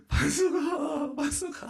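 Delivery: high wailing cry, slow and drawn out; a father crying out for his lost son; imitating sobbing. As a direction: wail the child's name through tears. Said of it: "막 울면서 다니는 거예요".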